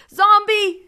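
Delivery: very high, very loud scream; enraged mother screaming after the boy as the door shuts. A narrator's reading. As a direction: hurl the insult with everything. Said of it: "she screamed".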